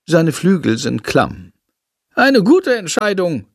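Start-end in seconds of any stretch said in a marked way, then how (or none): 2.1-3.5 high, pleased bat voice; praising bat. Acted. Bat praising the boy, high and pleased. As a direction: lift the voice up for a small proud bat. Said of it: "lobt er Jendrik".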